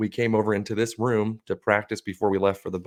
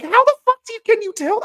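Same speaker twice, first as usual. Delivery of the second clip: much higher, louder disbelieving cry; incredulous; 8 dB louder, faster than usual. Retold or imitated. Asking the friend at the bar, high and loud in disbelief. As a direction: shriek it in utter disbelief.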